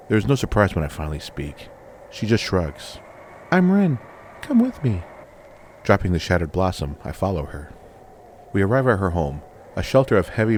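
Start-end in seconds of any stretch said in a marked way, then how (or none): 3.5-5.0 put-on high, louder little girl; young girl voice. A narrator's reading. Rin invites the stranger home, high childlike voice. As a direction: cheerfully invite him like a small child.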